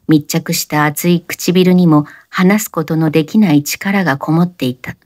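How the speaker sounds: ordinary delivery, nothing marked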